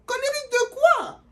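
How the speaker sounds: very high, loud incredulous squeal; outraged disbelief. Retold or imitated. Answering the girl, shocked and shrill.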